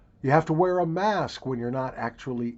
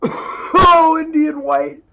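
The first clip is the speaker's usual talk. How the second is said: high, loud yelp through a snuff hit; explosive sneezy wail; snuff sneeze outburst; 11 dB louder, much slower than usual. Real, not acted. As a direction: cry out as the snuff burns. Reacting to too deep snuff.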